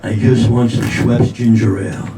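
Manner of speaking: put-on low, defeated elderly mumble; low-pitched old man's mutter; muttering old husband. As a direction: grumble to yourself like a henpecked old man. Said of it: "the husband's muttering himself".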